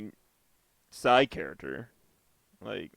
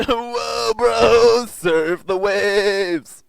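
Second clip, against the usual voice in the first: surfer dude voice; 12 semitones higher, 14 dB louder, much slower than usual. Pretend. Mocking surfer stoner voice.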